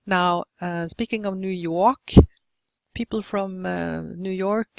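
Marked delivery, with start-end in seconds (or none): none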